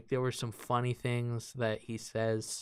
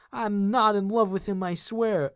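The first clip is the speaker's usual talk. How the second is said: high, louder hammed-up denial; mock protesting girlfriend; 8 semitones higher, 5 dB louder than usual. Mocking girlfriend insisting she is not in love, exaggerated protest. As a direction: overdo her protest, pitched way up.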